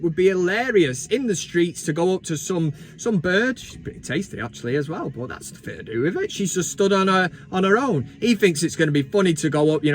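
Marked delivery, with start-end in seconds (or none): 3.6-6.3 hushed aside. Real, not acted. Quiet aside about the woman.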